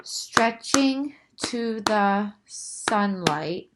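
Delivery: very slow, tentative reading; trying a haiku line. Shown as a demonstration. Teacher tests a second haiku line. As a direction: test stretching to the sunlight slowly. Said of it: "that's only six".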